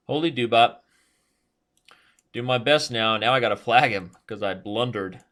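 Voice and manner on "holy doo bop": quick, punched exclamation of dismay; brisk, resigned repeat of an oath; short exclamation. Real, not acted. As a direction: say it short, with HOLY hit hard.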